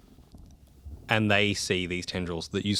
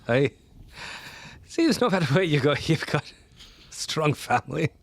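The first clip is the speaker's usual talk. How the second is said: wounded granddad; 7 semitones higher than usual. Acted. Granddad speaks to Banji, wounded.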